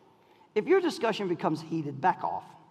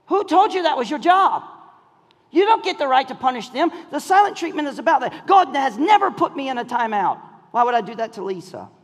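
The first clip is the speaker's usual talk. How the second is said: raised, forceful preacher voice; full-force preaching; 7 semitones higher, 5 dB louder than usual. Real, not acted. Preacher confronting couples over the silent treatment, full-force preaching. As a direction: challenge them hard, building in pitch and volume.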